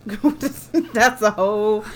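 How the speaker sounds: laughing through the words; words broken by laughter. Real, not acted. Laughing at friend's joke.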